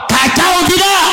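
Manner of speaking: high-pitched call to the crowd; rally-style shouted question; rousing shout. Real, not acted. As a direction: demand a response from the congregation. Repeats louder question to rouse crowd.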